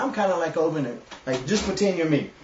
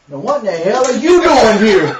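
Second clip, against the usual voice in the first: loud, higher, laughing mock bark; angry old man; 7 semitones higher, 11 dB louder than usual. Grumpy dad confronting a stranger, loud and laughing. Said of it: "he just walks over there and he goes".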